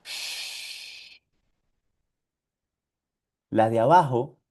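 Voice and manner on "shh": "Shh" as a whispered hush. Shown as a demonstration. Teacher shows the Spanish hush, whispered. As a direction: hush someone softly. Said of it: "mandas a callar a alguien".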